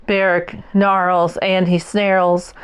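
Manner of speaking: ordinary delivery, nothing marked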